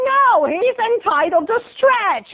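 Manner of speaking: put-on very high, slow scolding old woman; hammed-up bitter old lady, drawing words out. Old lady defends the man stretching, bitterly. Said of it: "the other lady's like".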